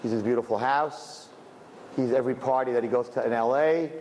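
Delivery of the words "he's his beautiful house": usual speaking voice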